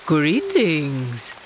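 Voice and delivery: a touch of a big cat's low welcome; lightly voiced leopard. Leopard answers door.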